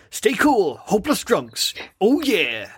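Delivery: high, cocky mascot catchphrase; hammed-up Duffman. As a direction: strut it like the beer mascot. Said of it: "He goes".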